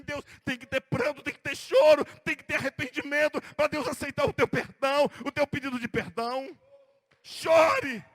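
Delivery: loud, forceful preacher's exhortation; fiery preaching. Acted. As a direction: drive the call to weep home. (pregação inflamada e forte)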